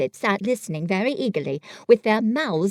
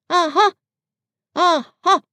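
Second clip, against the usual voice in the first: higher, louder trial Aha; practising Aha; 8 semitones higher, 6 dB louder than usual. Acted. Pooh practising Aha for the plan, testing it. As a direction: try the word out, testing it.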